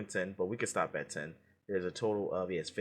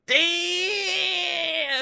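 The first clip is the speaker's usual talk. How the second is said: 1 amazed shout; 7 dB louder than usual. Real, not acted. Guest gets a hard answer right.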